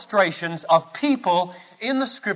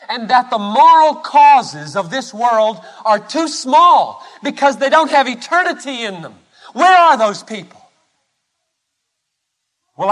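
high, loud, driving sermon delivery; forceful preaching; 5 semitones higher, 5 dB louder than usual. Acted. Preacher asking where the heavenly-minded people are, forceful preaching. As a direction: preach it with rising urgency.

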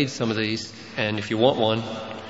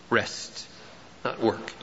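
hushed aside; 3 semitones lower, 10 dB quieter, slower than usual. Acted. Hushed comment after verse.

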